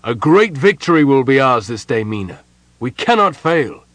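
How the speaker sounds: hearty, forceful battle confidence; rousing boast. A narrator's reading. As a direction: declare victory to your leader. Captain boasts of sure victory.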